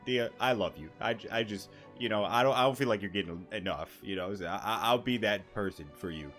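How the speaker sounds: ordinary talk, nothing marked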